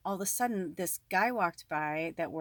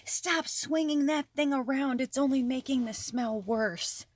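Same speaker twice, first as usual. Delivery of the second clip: scolding woman; 6 semitones higher than usual. Retold or imitated. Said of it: "And she goes".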